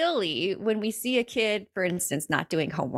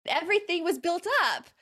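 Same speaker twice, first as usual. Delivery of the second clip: emphatic, amused; 13 semitones higher, faster than usual. Real, not acted. Stressing the build-up while laughing.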